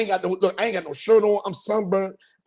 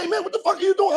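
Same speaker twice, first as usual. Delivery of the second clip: high-pitched comic outrage; alarmed scolding; 13 semitones higher, faster than usual. Retold or imitated. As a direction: act out scolding a dozing passenger. Driver scolds sleepy passenger.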